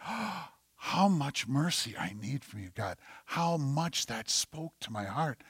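very soft, breathy reenacted weeping prayer; tearful prayer. Worshipper moved by God's mercy, praying softly as if crying. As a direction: plead quietly to God, dropping into a whisper. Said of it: "I'm crying up here going".

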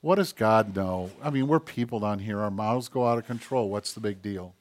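low, soft offhand shrug; dismissive mocking. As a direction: brush God off quietly, voice dropping away. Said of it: "I can go like this".

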